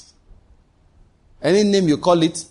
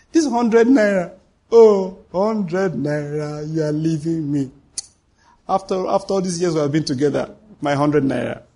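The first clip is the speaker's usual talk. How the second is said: mock lament to money. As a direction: bid the money a sad goodbye, half joking. Mock farewell to his money.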